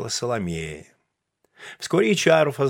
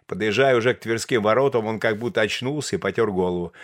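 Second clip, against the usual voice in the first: usual speaking voice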